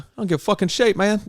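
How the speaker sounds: high-pitched, hammed-up English accent; terrible English accent. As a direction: botch an Englishman's indignant retort. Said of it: "that was a terrible English accent".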